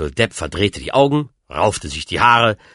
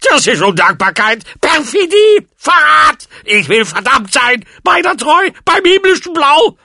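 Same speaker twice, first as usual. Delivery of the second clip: put-on high, screaming old man's rant; loud, shrieked indignation; enraged tirade; 8 dB louder than usual. A narrator's reading. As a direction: rage at the top of your voice. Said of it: "der aufgebrachte Alte".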